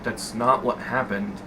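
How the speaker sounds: soft, low retold denial; low, subdued retort. Employee answering his yelling boss, low subdued denial. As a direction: drop low and quiet to deny it.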